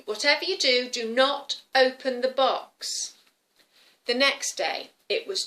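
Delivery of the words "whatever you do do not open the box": stern warning. Acted. Grandma warns the girl.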